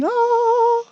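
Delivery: high mock preacher begging for cash; mock faith healer. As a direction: ham up a greedy TV healer. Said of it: "and healing".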